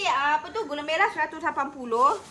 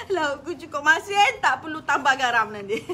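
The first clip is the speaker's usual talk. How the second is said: joking while crying; laughing through tears; 6 dB louder than usual. Real, not acted. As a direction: wipe the tears with a joke. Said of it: "Cukup-cukuplah menangis". (ketawa dalam tangisan)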